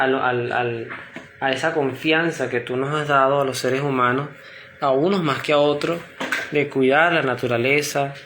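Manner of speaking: ordinary talk, nothing marked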